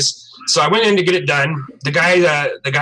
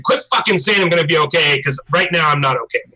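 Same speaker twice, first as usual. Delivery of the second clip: ordinary talk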